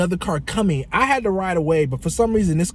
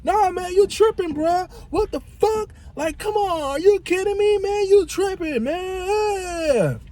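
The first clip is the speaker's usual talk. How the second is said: very high-pitched, wailing imitation; mock hysterical outrage; slower than usual. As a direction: squawk in disbelief, stretching the last word into a wail. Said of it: "he yells at me".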